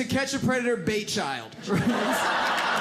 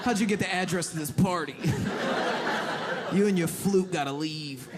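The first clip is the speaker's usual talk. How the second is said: lower dismissive bro; 5 semitones lower than usual. Retold or imitated. Party guys rejecting the nerd.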